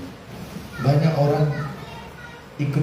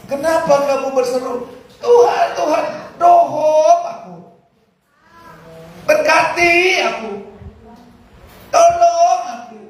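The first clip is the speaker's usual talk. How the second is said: high, loud whining plea to God; imitating a needy pray-er crying Tuhan; mock pleading prayer; 13 semitones higher, 4 dB louder than usual. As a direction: ham up a begging worshipper. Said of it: "Kalau dalam bahasa kita".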